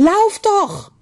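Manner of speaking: high, insistent yell, falling at the end; shouting. A narrator's reading. Julebug calling to the fleeing children, shouting. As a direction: cry out urgently for them to run. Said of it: "hörte er Julebug schreien".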